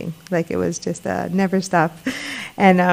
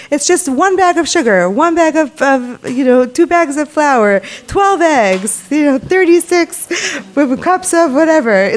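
higher and louder, brisk mother listing ingredients; voicing her mother; 6 semitones higher, 4 dB louder than usual. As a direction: rattle it off like a busy mom. Mother's words voiced by daughter.

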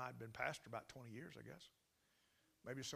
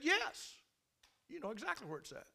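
high, loud "Yes" then a quick accusing jab; angry snap; 11 semitones higher, 6 dB louder than usual. Retold or imitated. Landlady snaps at the pastor, high and accusing. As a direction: bite back in disbelief. Irate lady on the phone.

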